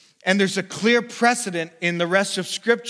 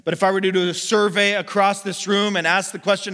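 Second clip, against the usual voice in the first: ordinary talk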